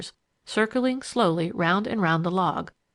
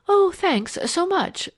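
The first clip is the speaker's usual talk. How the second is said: high, slow, drawn-out thanks; grateful; 12 semitones higher, 4 dB louder, slower than usual. A narrator's reading. Eileen thanking Leslie for the soup, high-pitched gratitude. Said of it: "replied with a grateful glance".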